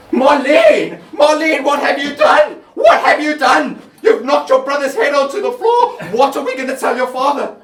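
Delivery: high, loud shocked scolding; mother discovering the dead son; horrified shout. Acted. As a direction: shout in horror at the child.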